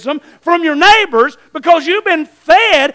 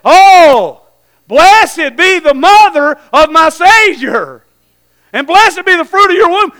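loud, higher cry of joyful praise; exultant blessing; 4 semitones higher, 8 dB louder, slower than usual. Acted. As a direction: proclaim it with long-drawn, Spirit-filled fervor. Elizabeth filled with Spirit, blessing Mary.

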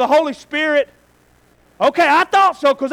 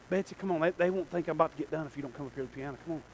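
quiet aside; 10 semitones lower, 15 dB quieter, much faster than usual. Real, not acted. Aside calling pianist to piano.

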